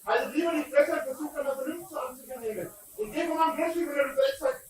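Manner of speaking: furious shouting at intruders; berserk rage. Real, not acted. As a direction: scream them off your land. Yelling at people on his property.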